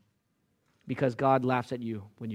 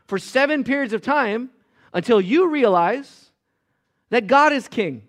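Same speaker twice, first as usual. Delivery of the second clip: loud, high, fervent rebuke; full-force preaching; 7 semitones higher, 8 dB louder than usual. Acted. Preacher confronting Nebuchadnezzar with loud, high fervent preaching. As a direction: thunder it at the proud king.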